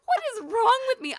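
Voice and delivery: high, loud, laughing at herself; mock self-scolding. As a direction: scold yourself in disbelief. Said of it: "I was like".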